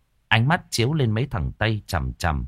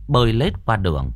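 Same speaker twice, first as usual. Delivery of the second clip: ordinary delivery, nothing marked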